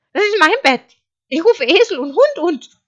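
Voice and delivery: high, loud sheep protest; indignant cry. A narrator's reading. Sheep protesting as wolf lands beside it, indignant cry. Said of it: "rief Schaf".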